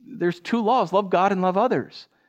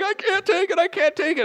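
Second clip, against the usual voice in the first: high-pitched mock panic; freaking out; 13 semitones higher than usual. Passenger freaking out in the back seat, high mock panic. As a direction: re-enact a frantic, squealed freak-out. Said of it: "freaking out in the back seat".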